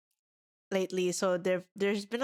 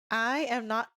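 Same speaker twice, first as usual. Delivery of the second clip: usual speaking voice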